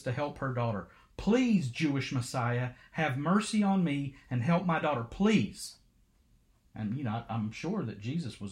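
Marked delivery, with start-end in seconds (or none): 1.2-5.8 crying out, begging. Acted. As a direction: cry out for mercy. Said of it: "crying out, begging Jesus".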